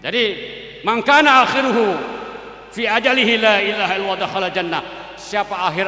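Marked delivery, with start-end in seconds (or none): 0.8-4.8 raised, ringing Arabic recitation; loud hadith recitation. Acted. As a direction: proclaim the hadith to the congregation. (membacakan hadis dengan lantang)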